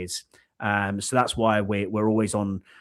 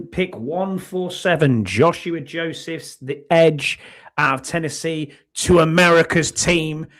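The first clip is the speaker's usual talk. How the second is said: slow, loud, higher announcer call; drawn-out, raised mock announcer voice; draft pick announcement; 4 semitones higher, 5 dB louder than usual. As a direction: announce the pick to a big crowd.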